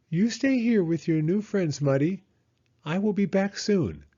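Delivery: a reassuring little hedgehog; Hedgehog's voice. A narrator's reading. Hedgehog telling Muddy to stay with friends, high and gentle.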